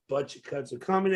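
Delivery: usual speaking voice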